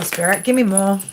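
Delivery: ordinary delivery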